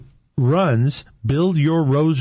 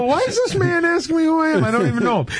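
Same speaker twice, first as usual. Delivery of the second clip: high-pitched, amused bewilderment; baffled disbelief; faster than usual. Retold or imitated. Man retelling being questioned by a stranger, baffled. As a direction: relive the bafflement, voice jumping up high.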